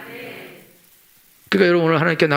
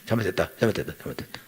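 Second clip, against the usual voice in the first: much softer, lower, rattled off under the breath; grudging mumbled apology; 10 semitones lower, 10 dB quieter, faster than usual. Retold or imitated. Husband mumbling the apology his wife demands, grudgingly soft. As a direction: mumble 잘못했다 over and over, barely voiced, just to get it done. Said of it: "열 번 해".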